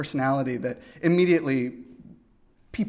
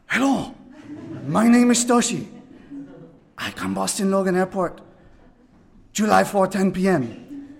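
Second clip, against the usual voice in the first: put-on higher Japanese-accented English, a bit lighter; imitating Toshi; 4 semitones higher, 3 dB louder than usual. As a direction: repeat the caller's accented message. Retelling Toshi's call again.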